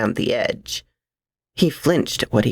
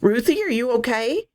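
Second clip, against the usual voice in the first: breathless and high; panting out the questions; out of breath; 9 semitones higher than usual. A narrator's reading. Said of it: "half out of breath".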